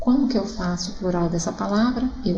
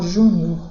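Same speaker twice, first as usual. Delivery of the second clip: júnior with the stress on JÚ; stress on first syllable; 2 semitones lower, 4 dB louder than usual. Shown as a demonstration. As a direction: lean on the opening "jú" of júnior. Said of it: "sílaba tônica ju".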